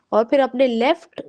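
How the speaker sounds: ordinary talk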